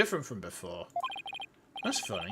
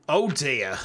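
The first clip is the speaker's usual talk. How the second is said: usual speaking voice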